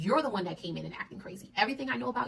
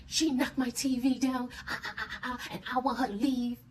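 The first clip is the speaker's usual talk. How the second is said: mock tattling, higher and drawn-out; whiny tattling husband; 4 semitones higher than usual. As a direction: imitate him complaining to the cops, stretching it out. Retold complaint to police.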